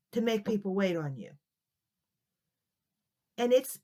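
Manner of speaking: usual speaking voice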